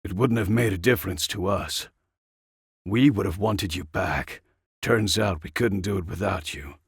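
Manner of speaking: plain reading voice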